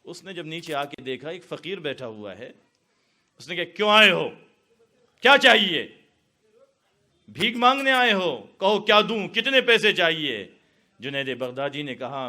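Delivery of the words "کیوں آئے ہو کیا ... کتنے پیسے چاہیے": loud, scornful demand at the door; harsh challenge. Acted. Woman scornfully shouts at the old man at her door. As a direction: bark it at the beggar.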